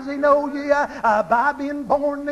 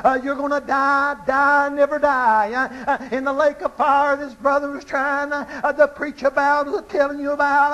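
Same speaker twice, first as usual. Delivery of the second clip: ordinary talk, nothing marked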